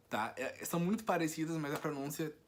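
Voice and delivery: ordinary delivery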